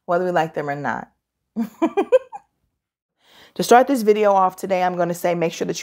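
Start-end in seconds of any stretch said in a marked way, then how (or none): 1.6-2.4 high, brief giggle; little high giggle; short laugh. Real, not acted. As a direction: let out a quick high-pitched laugh. Laugh after the intro.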